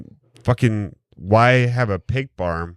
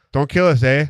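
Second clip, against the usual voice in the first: mock Canadian accent; 5 semitones higher than usual. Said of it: "we use A a lot".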